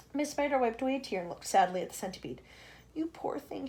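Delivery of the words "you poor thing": soft, tender murmur. Acted. Spider comforting the centipede in a soft murmur. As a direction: murmur sympathy. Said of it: "she murmured".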